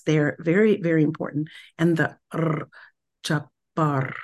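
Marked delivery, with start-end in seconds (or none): 3.2-4.1 "jabar" with the R rolled so it keeps vibrating; "jabar" sung out gently, rolled R held. Shown as a demonstration. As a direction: let the R in "jabar" keep buzzing on. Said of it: "the r is like it keeps that vibration going".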